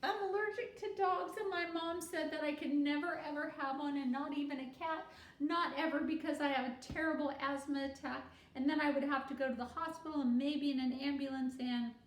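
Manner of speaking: put-on anxious girl rambling about her asthma. A narrator's reading.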